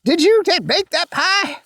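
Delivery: high-pitched mock churchgoer gushing over pie; chatty churchgoer. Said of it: "you'll hear".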